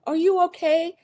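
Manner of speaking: high, shocked gasp of concern; alarmed cry. Retold or imitated. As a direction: rush in frightened for her friend. Lisa reacting to crash.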